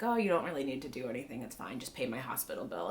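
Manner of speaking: low, soft, offhand brush-off; mock casual. He negotiates with Gunso from hospital, mock casual. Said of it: "the casualness with which that was agreed to".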